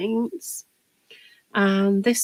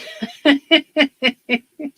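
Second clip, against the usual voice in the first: laugh; 6 semitones higher, 7 dB louder than usual. Real, not acted. Laughs at own brush-in-mouth habit.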